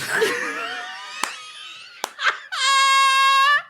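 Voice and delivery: a big high burst of laughter; loud high laugh. Real, not acted. Cracking up over the twist, loud high laugh.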